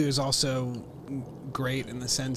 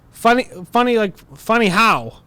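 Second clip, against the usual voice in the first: higher, louder, slowed challenge; Pesci's challenge; 9 semitones higher, 12 dB louder than usual. Retold or imitated. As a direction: drag out funny how. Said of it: "and he goes".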